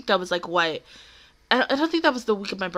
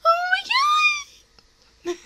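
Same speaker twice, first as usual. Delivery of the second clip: squeal; much slower than usual. Real, not acted. Squealing at anime on screen.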